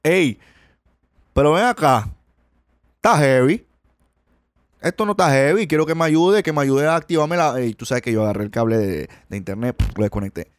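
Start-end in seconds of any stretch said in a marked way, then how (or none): 0.0-7.6 put-on Cuban accent. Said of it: "estoy cambiando el acento".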